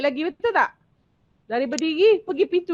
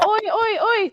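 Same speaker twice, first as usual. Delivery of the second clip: higher rude call of oi, oi, oi; rude shouting; 9 semitones higher, slower than usual. Retold or imitated. Teacher showing pupils rude call to avoid, rude shout. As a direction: bark oi at a guest like a rude kid. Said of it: "Jangan cakap".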